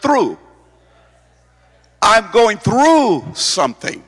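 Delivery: "through" stretched out slow and emphatic; stress on THROUGH. Shown as a demonstration. Preacher showing the congregation where emphasis goes, stressing "through". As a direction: punch and hold the word "through". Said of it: "You have to put emphasis on the word".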